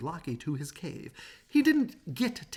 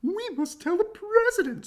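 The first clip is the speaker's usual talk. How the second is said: much higher panicked hen cry; clucking hen; 7 dB louder than usual. Acted. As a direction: squawk it out like a frantic chicken. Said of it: "clucked Chicken Licken".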